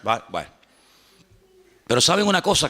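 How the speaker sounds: ordinary talk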